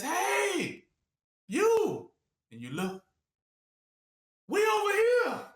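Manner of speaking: high, calling across the field. Retold or imitated. A friend calling the lost warrior over, high call. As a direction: hail them from far off.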